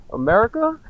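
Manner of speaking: "America?" said through high laughter; laughing. Real, not acted. Mocking the comparison to the host, laughing. Laughing repeat of own question.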